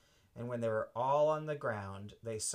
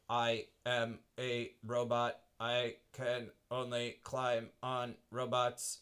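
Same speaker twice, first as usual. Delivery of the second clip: slow lightly robotic voice. Acted. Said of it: "one of the robot ... said".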